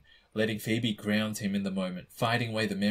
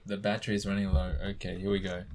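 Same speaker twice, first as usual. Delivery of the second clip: ordinary delivery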